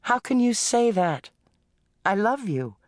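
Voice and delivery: a wife hurt and protesting her love; Helen, upset. A narrator's reading.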